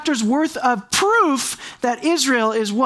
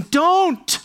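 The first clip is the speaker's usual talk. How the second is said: sharp command; 7 semitones higher than usual. Acted. Preacher's sudden command.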